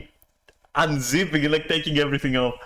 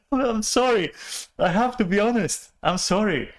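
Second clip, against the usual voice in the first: higher, laughter still in the voice; laughing apology; 9 semitones higher than usual. Real, not acted. Still laughing while saying sorry to viewers. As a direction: apologise through the giggles. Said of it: "I'll try to stop cackling".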